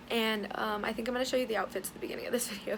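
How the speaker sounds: ordinary talk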